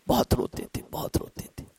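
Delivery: whispered, soft and low; hushed repetition. Acted. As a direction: let the words sink to a whisper. Describing the imam weeping. (سرگوشی میں دھیما لہجہ)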